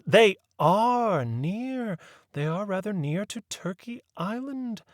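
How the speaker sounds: put-on a proper old lady leading the inquiry; prim lady voice. A narrator's reading. Mary Mabel realizes the bootleggers.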